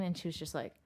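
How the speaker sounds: normal talking voice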